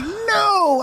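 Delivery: very high, drawn-out wail of no; hammed-up horror at bad news; horrified disbelief. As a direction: wail it in comic dismay, way up high. Reacts in horror to surprise cake.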